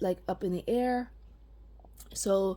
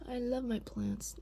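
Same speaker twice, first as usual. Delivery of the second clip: tender whisper; 11 dB quieter than usual. Real, not acted. Whispering to her plants.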